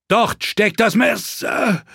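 high, drawn-out yell, cut short; alarmed shout. A narrator's reading. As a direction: shout in alarm until a grunt cuts it off. Jon shouts as knife is drawn. (hoher, gedehnter Alarmschrei)

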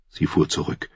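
usual speaking voice

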